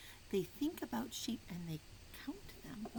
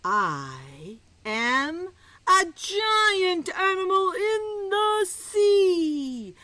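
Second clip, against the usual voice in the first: much louder, very slow and drawn-out; giant whale voice; 10 semitones higher, 13 dB louder, much slower than usual. Acted. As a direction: boom it out like a huge sea creature. Whale speaks in the riddle.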